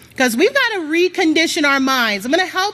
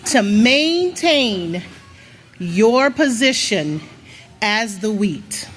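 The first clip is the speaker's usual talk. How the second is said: each word slowed and lowered, leaning hard on it; slow emphatic stress; 5 semitones lower, much slower than usual. Real, not acted. Preacher to congregation, slow and weighty. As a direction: drag it out so it sinks in. Preacher drives home key phrase.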